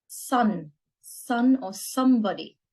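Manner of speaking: ordinary talk, nothing marked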